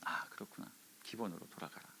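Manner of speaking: low, near-whispered inner voice; quiet realization. Retold or imitated. Preacher recalling God's answer to him, hushed. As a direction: murmur it as a private insight.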